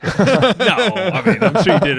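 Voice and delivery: high, loud laugh breaking into words. Real, not acted. Host laughing as guest calls himself annoying, laughing. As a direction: laugh it off warmly, fast.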